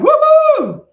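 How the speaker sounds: high, loud "woohoo" cheer; jubilant, drawn-out high holler; celebratory whoop. Retold or imitated. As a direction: whoop it out in triumph. Celebrating weight loss.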